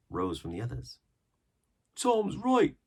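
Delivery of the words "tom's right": much higher, louder pirate voice; rough pirate. A narrator's reading. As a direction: chime in as a grumbling crewman. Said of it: "said one".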